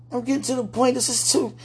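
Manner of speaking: usual speaking voice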